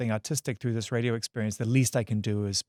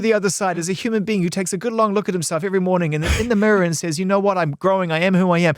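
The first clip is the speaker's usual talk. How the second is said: higher and louder, hammed-up profound host; mock deep interviewer; 7 semitones higher, 5 dB louder than usual. Host parodies his own deep question to the guest, hammed-up and louder. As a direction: overplay the grand soulful question. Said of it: "I hit you with the deep one".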